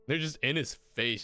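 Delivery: normal talking voice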